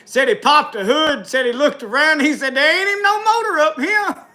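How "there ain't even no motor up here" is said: much higher, baffled attendant; high, astonished mock exclamation; mock surprise. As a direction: play the attendant staring at the empty trunk. Attendant's puzzled line retold.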